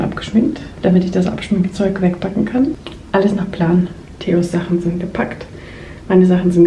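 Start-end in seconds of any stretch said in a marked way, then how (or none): none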